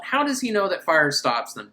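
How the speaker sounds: normal talking voice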